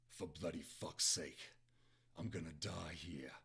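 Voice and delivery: low, slow British curse; lightly put-on British accent, subdued. Shown as a demonstration. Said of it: "I'm gonna go with the British accent".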